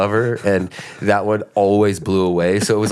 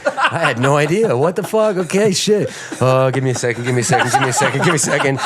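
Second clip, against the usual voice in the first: high, laughing mock panic; retold fluster, higher and amused; flustered reaction; 5 semitones higher than usual. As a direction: scramble for an answer, pitched up and laughing. Acts out panicked scrambling for idea.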